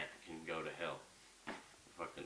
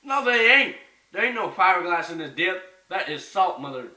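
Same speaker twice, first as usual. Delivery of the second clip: much higher, much louder furious shout; heated shouted retort; 11 semitones higher, 19 dB louder than usual. Retold or imitated. Shouting down anyone who says dip has fiberglass, furious yell. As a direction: blast it back at the fiberglass believers. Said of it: "And I'll be like".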